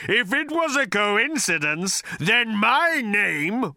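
high, slow, roaring fury. A narrator's reading. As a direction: roar out the threat. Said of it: "roared the voice".

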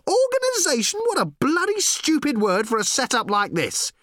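very high, incredulous outburst; outraged yell. A narrator's reading. Reporter rails at the new boss's word, yelling. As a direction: yell it at the top of your range. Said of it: "yelled Ford".

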